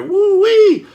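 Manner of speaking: ordinary talk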